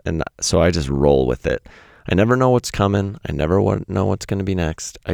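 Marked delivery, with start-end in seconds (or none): none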